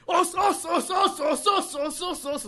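rapid-fire high, loud club chant; high-pitched shouted drill; drill shouting. Acted. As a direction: bellow the greeting over and over. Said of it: "オス練って言って、 オスの練習".

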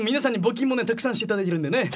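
quick, hurried read-through; rushed reading. Real, not acted. Radio host thanks listeners for donations, rushed reading. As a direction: rattle it off as if reading. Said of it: "なんで早口で読んでてんの？".

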